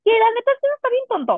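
high, fast exasperated rant; exasperated outburst. Real, not acted. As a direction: rant that it is dumb.

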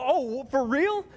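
high, slow, stunned imitation; mock surprise. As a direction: act out being caught off guard.

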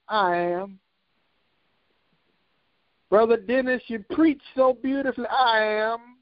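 high ringing preaching cadence. Real, not acted. Pastor praising church members, full preaching cadence. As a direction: preach it out, lifting each line.

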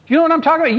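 put-on exasperated girl. Girl pressing him, high and exasperated. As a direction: push the question, impatient.

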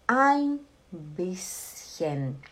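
ein bisschen stretched very slowly, syllable by syllable; teacher slowly modelling ein bisschen; slow model phrase. Shown as a demonstration. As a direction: draw out ein bisschen for the class to copy. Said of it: "Repete todo mundo junto comigo".

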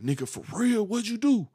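a lightly voiced friend who can't believe the news; excited friend. Friend voiced in retold call.

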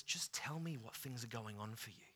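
soft, gentle invitation; Jesus gently inviting the disciples to speak; compassionate. Retold or imitated. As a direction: ask tenderly, like a caring friend. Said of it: "a heart of compassion".

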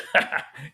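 short mock burst of laughter; imitated laugh. Retelling the doctor's reaction to a dying patient, imitated laugh. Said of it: "he started laughing".

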